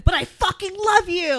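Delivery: high, drawn-out mock declaration; impassioned mock outburst. Friend finishing the pretend breakup rant, melodramatic outburst. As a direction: blurt it as a melodramatic confession. Completing the demonstrated yelling rant.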